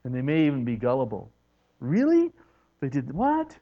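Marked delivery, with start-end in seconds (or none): 1.8-3.6 sharply higher "Really?", wide-eyed disbelief; naive surprise. Acted. Gullible believer hearing a shocking story, naive surprise. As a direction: take the news like a trusting innocent.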